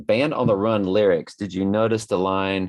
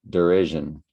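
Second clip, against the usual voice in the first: ordinary talk, nothing marked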